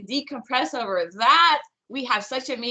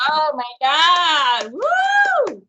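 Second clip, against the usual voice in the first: a drawn-out gasp, then a high whooping woo; thrilled cheer after the song; excited cheer; 4 semitones higher, 7 dB louder, much slower than usual. Real, not acted.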